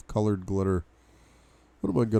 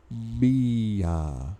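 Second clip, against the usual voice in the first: plain reading voice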